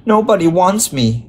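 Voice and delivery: understated near-crying plea; near tears. A narrator's reading. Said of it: "Michael had nearly cried".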